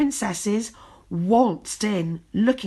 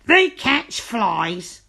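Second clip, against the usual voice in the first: witch voice; 4 semitones higher, 5 dB louder, much slower than usual. A narrator's reading. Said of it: "cried the friendly witch".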